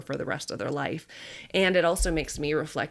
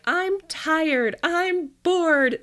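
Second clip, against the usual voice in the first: put-on high whining little-girl voice, a bit louder; whiny bored kid; 12 semitones higher, 4 dB louder, much slower than usual. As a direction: whine like a child tired of walking. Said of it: "she's like".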